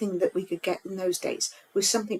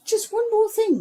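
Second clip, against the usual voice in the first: higher, louder, repeating to be heard; insistent; 11 semitones higher, 4 dB louder than usual. Real, not acted. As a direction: cut in firmly.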